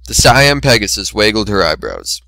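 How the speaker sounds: plain reading voice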